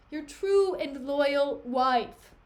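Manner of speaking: slow, loud insistence; acted, emphatic declaration of loyalty; insistent protest. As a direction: stress each word as a loyal wife protesting. Desdemona protests her loyalty to Othello.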